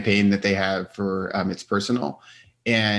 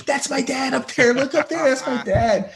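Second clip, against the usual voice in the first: put-on much higher, proud child voice; excited kids. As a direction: imitate kids showing off to a stranger. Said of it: "knocking on the window going".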